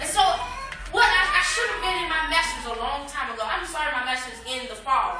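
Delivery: high, loud testifying cadence; preaching at full force. Acted. To the congregation, confessing her fear, preaching loud. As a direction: testify to the church with rising fervor.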